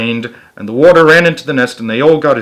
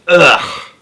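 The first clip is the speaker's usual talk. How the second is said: disgusted ugh; 4 semitones higher, 5 dB louder than usual. A narrator's reading. Disgust at slug burgers.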